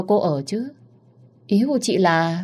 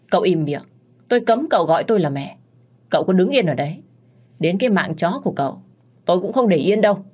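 loud, rising angry threats; furious rebuke; 3 semitones higher, 3 dB louder, faster than usual. Acted. Wife's mother threatens the husband, furious and loud. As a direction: tear into him, louder at the end.

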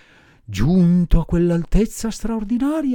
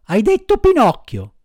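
high, louder puzzled repeat; startled, eager echo; excited surprise; 9 semitones higher, 5 dB louder than usual. Acted. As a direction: jump up at the name. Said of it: "saltando subito in piedi".

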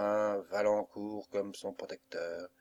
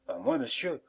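ordinary delivery, nothing marked

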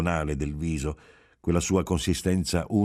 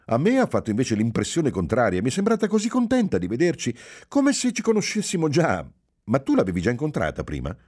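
much higher, quicker voice as the wife; lively young wife, a little louder and faster; Giulia, eager wife; 8 semitones higher, 4 dB louder, faster than usual. A narrator's reading. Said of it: "esclamò Giulia". (voce alta e vivace della moglie)

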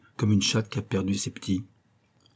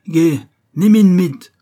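much higher and louder, slow barked command; furious officer shouting in German; shouted German order; 9 semitones higher, 11 dB louder, slower than usual. Acted. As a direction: bellow the arrest order.

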